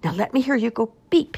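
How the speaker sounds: high, insistent command. A narrator's reading. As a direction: urge him firmly to try it. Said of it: "he commanded".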